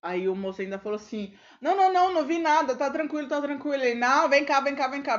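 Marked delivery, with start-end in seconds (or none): 1.6-3.8 high, loud panicked pleading; scared man. Retold or imitated. Robbery witness pleading with the robber, high and panicked. 4.0-5.2 mock robber barking orders, louder; robber commanding. Robber grabbing the old man, mock commanding.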